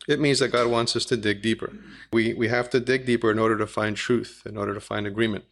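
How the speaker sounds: usual speaking voice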